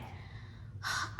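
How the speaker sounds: long, quiet exhale; exasperated sigh. Real, not acted. Friend venting that she's mad, exasperated sigh. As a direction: sigh out the frustration.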